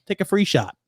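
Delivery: usual speaking voice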